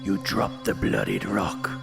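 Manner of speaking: ordinary delivery, nothing marked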